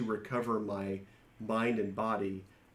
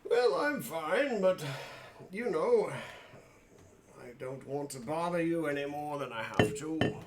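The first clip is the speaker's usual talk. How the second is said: higher polite adventurer voice to the librarian; character voice; 5 semitones higher than usual. Acted.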